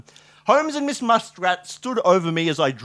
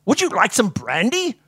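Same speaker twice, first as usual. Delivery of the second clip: higher-pitched, chirpy question; bright offer; 8 semitones higher, 4 dB louder than usual. A narrator's reading. Narrator brightly offers a drink as Watson, perky. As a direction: offer it brightly, lifting at the end. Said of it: "I asked brightly".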